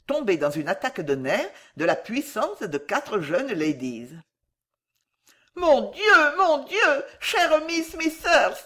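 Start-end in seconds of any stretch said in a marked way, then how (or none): 5.6-8.6 high wail of dismay, slowing at the end; horrified exclamation. A narrator's reading. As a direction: exclaim in dismay over a fainting friend. Pupils exclaim as Miss Smithers faints.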